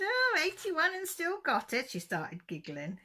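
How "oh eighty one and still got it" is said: much higher and louder, a put-on sing-song tease; cheeky teasing. Teasing her eighty-one-year-old aunt about the lad, high cheeky mock voice. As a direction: rib her like a mischievous niece.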